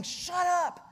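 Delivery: high, scolding crowd voice; annoyed crowd rebuke. Acted. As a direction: tell off a noisy beggar. Crowd hushing the beggar.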